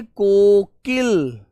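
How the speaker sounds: Kokil stretched very slowly, each sound held; drawn out for pupils. Shown as a demonstration. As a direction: say Kokil very slowly so the children repeat it. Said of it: "क्या बोलते हो प्यारे बच्चे?".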